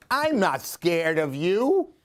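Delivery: ordinary delivery, nothing marked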